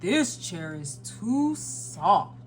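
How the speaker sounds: slow storybook Santa voice; Santa Claus. A narrator's reading. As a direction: draw the complaint out slowly in character.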